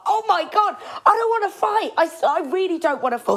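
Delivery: high, fast panicky pleading; panicked plea. Retold or imitated. Friend retelling a bar scuffle, panicked high plea. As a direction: beg frantically not to fight.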